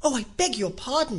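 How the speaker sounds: great dismay. A narrator's reading. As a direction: blurt the apology in dismay. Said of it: "in a tone of great dismay".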